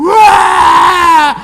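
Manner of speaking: high mock crying wail for mama. Priest mimicking retreat kids wailing for mama. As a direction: imitate children bursting into tears, high and loud. Children wailing during prayer.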